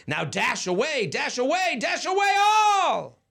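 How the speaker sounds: rising from plain to a shout; building shout. Acted. Building call to reindeer.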